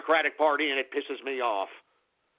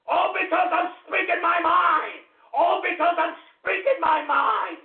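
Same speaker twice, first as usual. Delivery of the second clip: righteous shout; 9 semitones higher than usual. Acted. Host shouts his creed of freedom to listeners, righteous shout. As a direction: proclaim it like a defiant patriot. Ranting about freedom and truth.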